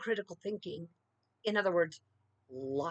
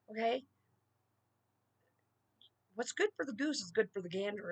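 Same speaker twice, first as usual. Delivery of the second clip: ordinary talk, nothing marked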